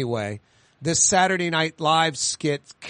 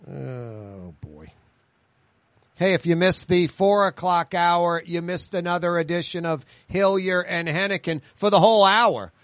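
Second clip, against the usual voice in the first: ordinary talk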